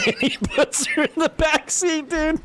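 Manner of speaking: high-pitched, laughing hard through the words; cracking up. Real, not acted. Telling a friend how she got put in the squad car, cracking up.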